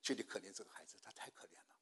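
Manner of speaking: low, hushed pleading, fading to a whisper; retold tearful prayer. Preacher prays for the possessed child, tearfully and softly. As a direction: plead softly for mercy, voice sinking away. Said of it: "我就，我就流泪祷告，".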